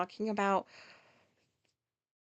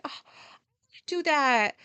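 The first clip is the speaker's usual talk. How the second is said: wistful eager quote; 5 semitones higher, 4 dB louder, slower than usual. Retold or imitated. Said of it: "I was like".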